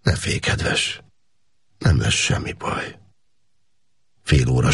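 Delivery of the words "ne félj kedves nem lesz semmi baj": tender, soothing. A narrator's reading. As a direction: soothe her gently like a lover. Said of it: "kedves, becéző hang volt".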